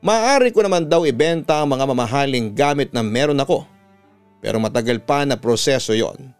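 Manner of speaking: usual speaking voice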